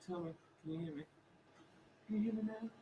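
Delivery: faint, far-off voice; sounding far away; distant mic. Real, not acted. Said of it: "[name] is distant".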